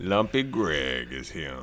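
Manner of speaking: Lumpy Greg character voice. Pretend. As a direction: drop into a slow lumpy oddball voice. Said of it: "we got a special guest called".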